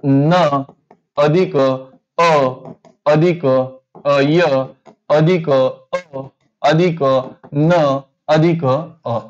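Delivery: very slow "न अधिक अ", each sound held and separated; sound-by-sound breakdown. Shown as a demonstration. Teacher splitting the word for students, slow separated "न अधिक अ". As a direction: pause between each piece, stretching "अ" and "अय". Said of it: "इथं उच्चार कसा होतो".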